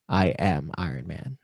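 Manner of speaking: lightly voiced low movie voiceover; Tony's voiceover. Closing voiceover after Tony drives off, low hero declaration. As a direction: drop the pitch for an understated closing line. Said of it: "the voiceover goes".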